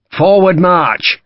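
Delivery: loud, high, slow order; command. A narrator's reading. Sharp orders the men forward, a loud slow bellow.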